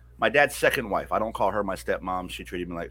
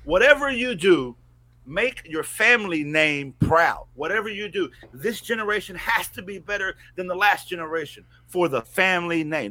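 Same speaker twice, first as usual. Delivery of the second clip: family's lesson; 7 semitones higher, 4 dB louder than usual. Retold or imitated. Family's lesson voiced emphatically.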